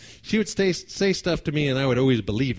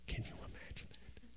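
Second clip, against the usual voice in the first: hushed unvoiced aside; whispered aside; 22 dB quieter than usual. Real, not acted. Storyteller confiding to audience in a whisper. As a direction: lean in and whisper it to the crowd.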